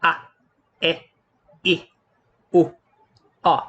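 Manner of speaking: each vowel cut short and said alone; isolated short vowels with a pause after each; short vowel sounds. Shown as a demonstration. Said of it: "short vowel sound".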